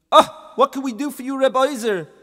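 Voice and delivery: high, loud welcoming rabbis; hammed-up sages; sages greeting. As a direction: hail him with eager welcome. Sages greet another visitor.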